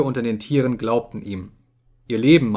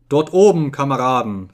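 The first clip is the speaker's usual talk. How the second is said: solemn; 2 semitones higher, 6 dB louder than usual. A narrator's reading. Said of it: "sagte er feierlich".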